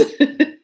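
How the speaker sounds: loud high laugh; burst of laughter. Real, not acted. Telling the Prince twist, a loud laugh. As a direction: laugh out at the absurdity.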